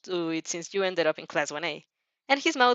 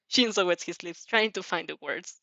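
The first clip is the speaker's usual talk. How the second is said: ordinary delivery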